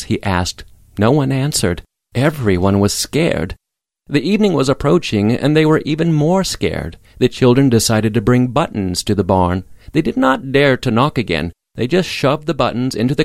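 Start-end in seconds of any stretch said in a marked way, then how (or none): none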